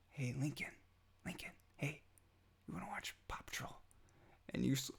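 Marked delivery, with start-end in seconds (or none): 0.1-3.8 put-on whispering to a sleeping child, fading into a breath; softer and softer, ending in a whisper; soft wake-up whisper. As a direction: coax him awake in a hush. Dad gently waking sleeping son.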